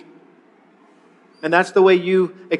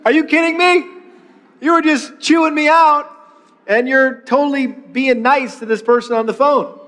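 mock outrage at a sudden switch to niceness; incredulous spouse; 6 semitones higher than usual. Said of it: "your spouse is like".

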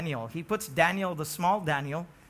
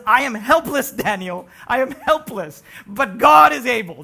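loud, high cry of helplessness; loud pleading king's voice; desperate cry; 3 semitones higher, 11 dB louder than usual. Acted. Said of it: "He says".

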